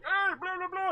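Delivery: mocking loud moviegoers. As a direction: parody noisy people yelling at the screen. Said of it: "I hate people being like".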